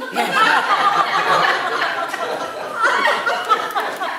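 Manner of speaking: a big high burst of laughter; loud laugh. Real, not acted. Funny McDonald's gift certificate story.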